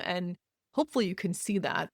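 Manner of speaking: ordinary talk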